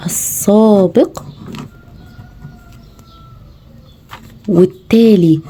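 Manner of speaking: each word drawn out very slowly, long pauses between; slow, spaced dictation to a young pupil. Shown as a demonstration. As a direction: dictate "السابق" and "والتالي" slowly to a child, word by word. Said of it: "ما تنسيش تقولي له اكتب".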